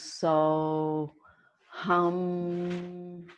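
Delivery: slow, sustained "so... hum"; drawn-out mantra. Shown as a demonstration. Teacher offering the class a breathing mantra, drawn out. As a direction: stretch each syllable and hold the final hum. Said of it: "You might like to use".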